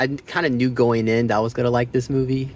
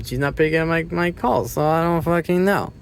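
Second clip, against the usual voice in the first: exasperated Monk; 7 semitones higher than usual. Retold or imitated. Voicing Monk's exasperation.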